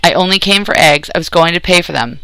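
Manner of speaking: ordinary delivery, nothing marked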